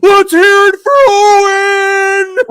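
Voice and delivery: loud, slow, high announcer yell; Tony Khan impression. Host hyping the crowd for Owen, loud slow announcer yell. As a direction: belt it out like a ring announcer. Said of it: "he takes the mic and he goes".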